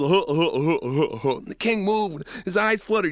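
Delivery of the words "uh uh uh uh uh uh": loud giraffe laugh. Acted. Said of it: "really let him have it".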